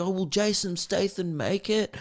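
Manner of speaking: high, soft, put-on fretting; mock-concerned viewer. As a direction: pretend to fear for the action hero. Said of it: "you're supposed to be like".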